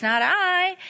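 high refusing cat voice; storyteller's high cat voice turning down the hen; lazy cat. A narrator's reading. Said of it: "the lazy cat says".

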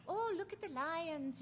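high, put-on South African tourist voice; South African accent. Speaker imitating tourists spotting lions, high mock South African accent. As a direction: imitate a South African sightseer, pitched well up. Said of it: "southern African accent".

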